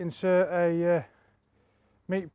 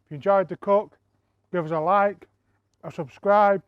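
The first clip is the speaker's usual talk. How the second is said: talking through a mouthful; words round a bite of food; mouth full; 3 semitones higher, 7 dB louder than usual. Real, not acted. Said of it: "I shouldn't talk with my mouth full".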